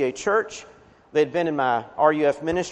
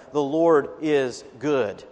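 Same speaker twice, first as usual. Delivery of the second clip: slow, measured "the Lord is good"; slow reading; 3 semitones lower, slower than usual. Shown as a demonstration. Preacher reading the verse aloud, slow and measured. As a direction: read the verse slowly, letting each word sit. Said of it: "we also hear in verse seven".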